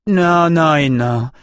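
slow, high, emphatic no after no; furious refusal, each non drawn out; vehement refusal. A narrator's reading. Finger pointed, furious refusal.

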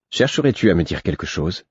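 ordinary delivery

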